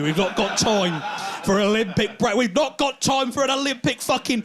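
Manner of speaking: high, loud laughing rant; exasperated outburst. Retold or imitated. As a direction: friend losing patience over breakfast. Exasperated friend in café.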